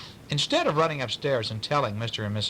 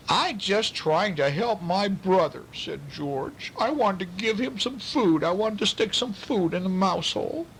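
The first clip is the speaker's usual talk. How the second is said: whiny George; 8 semitones higher than usual. A narrator's reading. George pleads his case.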